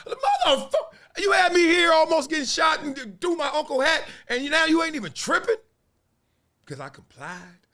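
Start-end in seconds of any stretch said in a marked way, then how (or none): 0.1-5.5 much higher, louder indignant yell; outraged rant. Retold or imitated. Comic venting at the banger who threw his uncle's hat, outraged yell. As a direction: yell it in disbelief at the guy.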